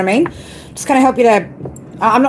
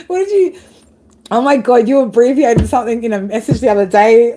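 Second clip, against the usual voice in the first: usual speaking voice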